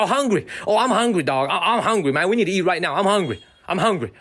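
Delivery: hammed-up sudden hunger; teasing the assistant who perks up at seafood; imitating Harry. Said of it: "He's like".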